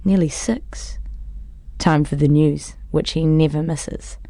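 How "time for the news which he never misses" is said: plain reading voice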